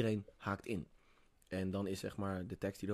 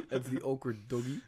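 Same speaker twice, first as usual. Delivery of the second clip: laughing; 5 semitones higher, 9 dB louder than usual. Real, not acted. Group laughing over meme.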